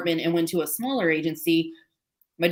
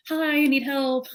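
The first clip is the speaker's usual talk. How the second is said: high, sweetly polite ask for help; overly polite call for help; mock polite request; 8 semitones higher than usual.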